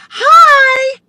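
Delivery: put-on high, loud little girl squeal of hi; excited little girl. Birthday girl greeting a stranger, high excited child voice. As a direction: chirp it like a bubbly small child. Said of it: "she goes".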